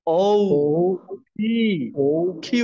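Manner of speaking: "ও", "পি", "কিউ" called out one by one; teacher prompting "ও", "পি", "কিউ"; pronunciation drill. Shown as a demonstration. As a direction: model "ও পি কিউ" with pauses for repeating. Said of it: "আর একটু জোরে বলেন".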